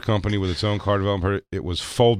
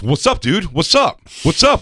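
confrontational shouts, rising and louder; aggressive tough guy; 10 semitones higher, 7 dB louder than usual. Retold or imitated. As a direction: square up, pumping yourself up louder each time. Aggressive tow truck driver, retold.

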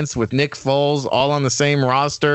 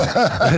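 short, high chuckle; brief burst of laughter; 7 semitones higher than usual. Real, not acted. Co-host amused by "scrizzled" joke, laughing.

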